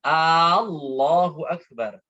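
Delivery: "Allahu Akbar" slow, the first A short; correct takbir. Shown as a demonstration. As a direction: keep the opening A of "Allahu" short. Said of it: "bukan A Allah". (takbir dengan alif pendek)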